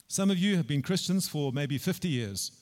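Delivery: ordinary talk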